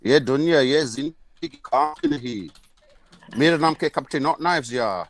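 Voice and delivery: imitating Bradford talk in another language; Bradford accent. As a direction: mimic Bradford locals chatting. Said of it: "they talk like this".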